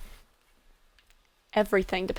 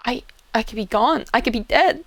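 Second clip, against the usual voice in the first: louder, panicked burst; worked up; 10 dB louder than usual. Acted. Scared roleplayer tells friend he could die, panicked burst. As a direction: blurt out the fear of dying. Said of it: "I'm getting worked up".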